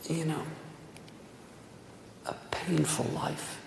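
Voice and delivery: breathy near-silent whisper, slowed; whispered like a sigh over a painful life; hushed whisper. Real, not acted. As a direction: let it fall away to a whisper. Somber aside after sad story.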